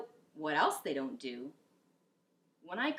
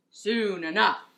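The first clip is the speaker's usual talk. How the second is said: crew echo; 9 dB louder than usual. Acted. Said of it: "the crew repeated".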